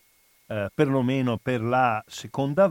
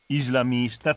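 usual speaking voice

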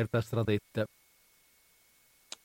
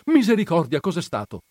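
high, loud first word, then dropping; startled cry; 6 semitones higher, 6 dB louder than usual. A narrator's reading. Perpetua hearing a cry in the night, startled shout. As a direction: cry out in alarm. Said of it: "gridò Perpetua".

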